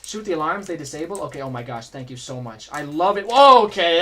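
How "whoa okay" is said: loud, startled exclamation after a close call; startled whoa. Real, not acted. Gamer surprised by the alarms, loud startled whoa. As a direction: blurt it out, caught off guard.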